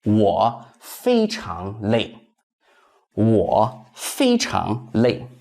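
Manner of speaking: slow, careful Mandarin for learners; slow teacher's demo; slow model sentence. Shown as a demonstration. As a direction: say it slowly, syllable by syllable, for students to copy. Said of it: "That is".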